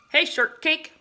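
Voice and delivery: high, loud friendly call; cheery shout from a passing cloud; cheery greeting. Acted.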